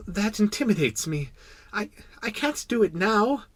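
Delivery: put-on high, bashful child voice; intimidated little prince. Little prince blushing before the king, intimidated. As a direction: falter shyly in a small high voice. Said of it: "blushing deeply".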